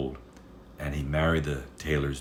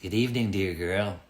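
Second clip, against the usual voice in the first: lightly voiced little man; 3 semitones higher than usual. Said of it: "said the little man".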